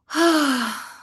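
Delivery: long, falling sigh; audible sigh. Real, not acted. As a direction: let out one audible settling breath. Settling before starting the session.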